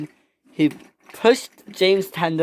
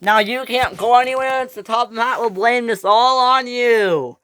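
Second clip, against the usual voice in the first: high, raised singsong jeer; gloating, high-pitched taunt; 7 semitones higher, 4 dB louder than usual. Acted. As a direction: rub it in that he takes the blame. Taunting James stranded without his tender.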